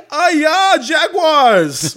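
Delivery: put-on loud, high-pitched Chinese-accented exclamation; mock Chinese accent. Restaurant host greeting a Jaguars hat, loud mock Chinese accent. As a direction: yell it like an excited Chinese uncle in a hammed-up accent. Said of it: "is Jackie Chan's uncle the, the proprietor".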